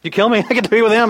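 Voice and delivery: ordinary talk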